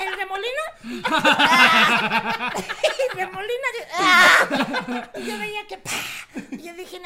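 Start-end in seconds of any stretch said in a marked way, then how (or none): 1.1-2.5 loud, lower fake moan; hammed-up loud moan; mock moaning. Mom's moans in sex anecdote. 3.9-5.1 hammed-up loud moan; mock moaning. Mom's moans in sex anecdote.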